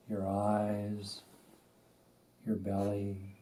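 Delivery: unhurried, spacious delivery, letting each instruction settle; guided meditation. Acted. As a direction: guide them gently into stillness, phrase by phrase.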